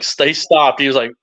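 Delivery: ordinary delivery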